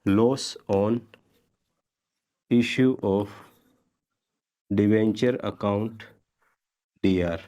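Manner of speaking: word by word with long pauses; spaced out as if writing along; slow dictation. Real, not acted. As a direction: dictate it piece by piece for note-takers. Dictating the entry while writing it.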